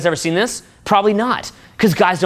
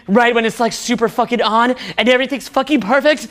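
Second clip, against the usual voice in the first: normal talking voice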